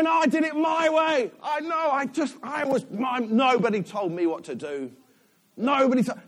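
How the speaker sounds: loud, high, imitated self-assertion; mock defiance. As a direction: shout it like someone insisting on their own way. Mimics self-willed people, defiantly.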